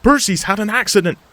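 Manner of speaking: high, loud alarm; frantic cry. Acted. Toby pulling in to warn Thomas, frantic. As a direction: cry out the bad news in a panic. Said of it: "he cried frantically".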